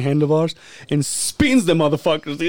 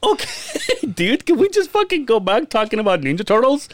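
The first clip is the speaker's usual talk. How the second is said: amused; 8 semitones higher than usual. Retold or imitated. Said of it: "We're like".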